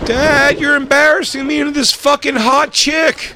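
put-on high, loud whiny kid; embarrassed teen. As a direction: groan it like a mortified teenager. Voicing embarrassed son.

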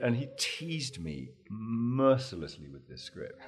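ordinary delivery

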